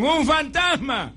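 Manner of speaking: slow, high, loud terrified shout; frightened cry. Retold or imitated. Disciples in the boat seeing Jesus, terrified shout. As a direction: cry out like you've seen a ghost. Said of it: "que gritaron".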